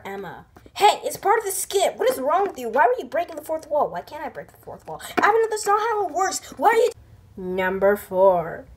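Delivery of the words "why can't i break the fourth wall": muttered aside. Real, not acted. Aside to viewers.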